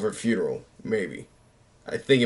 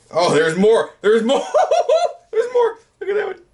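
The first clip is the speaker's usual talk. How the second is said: laughing; 4 dB louder than usual. Real, not acted. Laughing excitedly at old photos.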